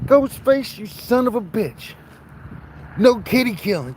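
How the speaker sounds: high, sharp scolding of the cow; mock scolding. Farmer scolds his cow for killing a kitty, high. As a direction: squeal at the cow to stop. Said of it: "I was all like".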